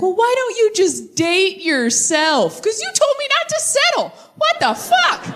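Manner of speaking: high-pitched, drawn-out indignation; exasperated outburst. Acted. Comic acting out an argument over breakup advice, exasperated and high. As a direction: climb very high into exasperated squawking.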